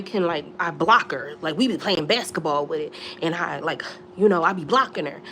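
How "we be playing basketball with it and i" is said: normal talking voice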